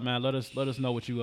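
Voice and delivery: normal talking voice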